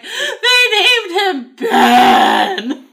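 high, loud squeal through laughter; thrilled laughing shriek; excited disbelief. Retold or imitated. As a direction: shriek it half laughing, half crying. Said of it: "I text Danny and I was like".

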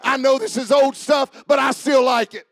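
loud, high, shouted preacher cadence; fired-up revival shout; full-force preaching. Real, not acted. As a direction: preach it out at the top of your voice.

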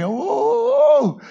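high eager cries of oh; Jesus happily ready, high excited oh. Acted. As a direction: let "oh" leap up with excitement.

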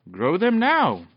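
high, loud urging; excited command. A narrator's reading. Excited command to goblin dog.

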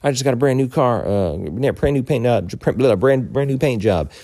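high, loud, sputtering mock outrage; imitating upset driver. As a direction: act out an indignant driver tripping over his words. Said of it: "he's understandably upset".

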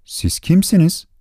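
high, astonished question. Acted. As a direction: ask in amazement. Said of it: "Şaşkınlıkla".

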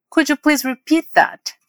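whole model phrase. Shown as a demonstration. Teacher modelling how to ask for a repeat, slow and connected. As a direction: say "Could you please repeat that" slowly in one flow. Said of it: "Vamos repetir palavra por palavra agora".

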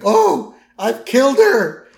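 high, loud mock panic; panicked Barney. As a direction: freak out in horror. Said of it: "He's like".

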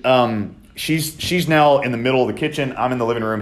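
ordinary delivery

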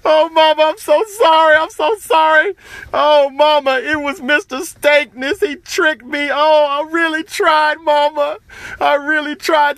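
high, loud wailing sobs; child crying out to his mother; bursting into tears. Acted. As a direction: wail the apology through tears. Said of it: "He just burst into tears".

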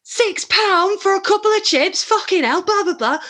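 high loud mock-Northern rant; northern accent. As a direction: grumble at the chip price like a Northern bloke. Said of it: "also from the north and is like".

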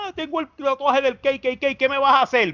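high, taunting mock challenge; mock yelling. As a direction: imitate a loudmouth picking a fight. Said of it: "si tú te vas a ir a gritarle a la gente".

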